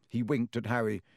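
plain reading voice

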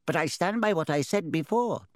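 high, knowing hat voice; talking hat. Acted. Sorting Hat speaking.

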